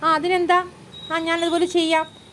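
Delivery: a hint of an obliging older brother; agreeable elder brother.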